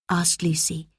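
ordinary delivery, nothing marked

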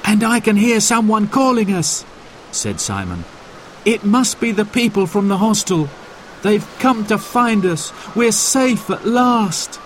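lightly voiced happy young boy; relieved boy. A narrator's reading.

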